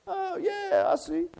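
high, soft pretend realization; mock sudden agreement. Spouse giving in mid-argument, mock agreeable and soft. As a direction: play an implausibly sweet concession.

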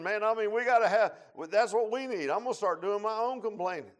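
loud, rising call to the church; loud preacher building to a climax; fiery preaching. Acted. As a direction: shout the need to the congregation. Preaching at full force.

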